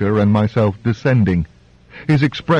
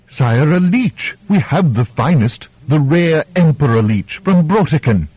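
pleading physician; 6 semitones higher than usual. Acted. Doctor pleading with injured king.

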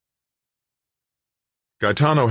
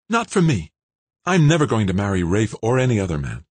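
put-on light, high girlish voice; young woman voice; 5 semitones higher, 3 dB louder than usual. A narrator's reading. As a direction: voice Kimberly bright and young. Kimberly voiced by narrator.

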